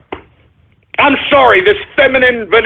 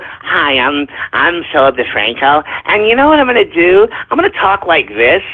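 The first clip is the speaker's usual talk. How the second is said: low, mincing mock of a smug YouTuber; mocking Philip DeFranco; 5 semitones lower than usual. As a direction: sneer through a prissy put-on intro voice. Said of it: "I'm tired of people".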